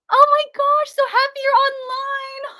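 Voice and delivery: high, loud laughing gush; delighted shout to her viewers; squealing happy laugh; overjoyed. Real, not acted.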